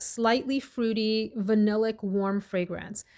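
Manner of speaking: ordinary delivery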